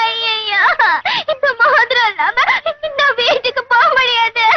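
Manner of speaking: wailing lament through sobs; crying. Acted. Girl afraid to go home without the ring, crying. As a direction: cry it out helplessly. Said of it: "அழுகாதீங்க".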